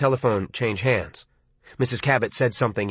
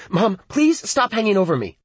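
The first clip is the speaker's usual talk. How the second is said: annoyed; 7 semitones higher than usual. Acted. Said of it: "moved slightly away from the telephone".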